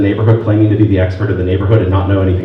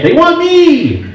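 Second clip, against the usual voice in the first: drawn-out, high-pitched triumphant cry; gleeful exclamation; 4 dB louder, much slower than usual. Acted. Teacher crowing that businesses want her, gleeful. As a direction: crow it high and stretch it out. Comic answer on businesses' behalf.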